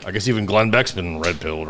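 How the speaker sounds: dismissive eye-rolling mock quote; imitating Jones. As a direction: drop the voice, roll your eyes. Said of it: "That's the way Jones says it".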